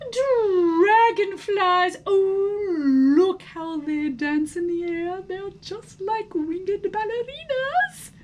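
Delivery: put-on high, enchanted girl's voice; dreamy little girl in wonder; delighted. A narrator's reading. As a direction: gush over the dancing dragonflies.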